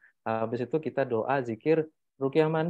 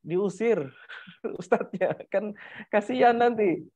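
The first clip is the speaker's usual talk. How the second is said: amused, higher voice breaking into chuckles; laughing; 7 semitones higher than usual. Real, not acted. Ustadz jokes about being turned away from a house, laughing. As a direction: talk through a laugh, pitch lifted.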